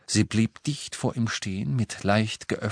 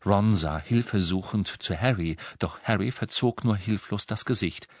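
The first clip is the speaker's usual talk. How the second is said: ordinary delivery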